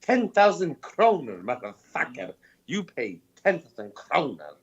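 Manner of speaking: ordinary talk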